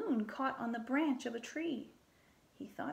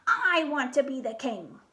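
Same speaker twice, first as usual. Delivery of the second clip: loud whiny outburst; angry tantrum; 4 semitones higher, 7 dB louder than usual. Acted. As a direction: shout it like a spoiled child. Archduke tantrum continues.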